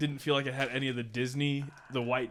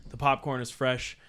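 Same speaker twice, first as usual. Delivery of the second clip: podcast host intro; 5 dB louder than usual. Acted.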